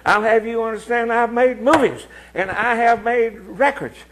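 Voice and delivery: mock indignant. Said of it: "you sound like an old cow".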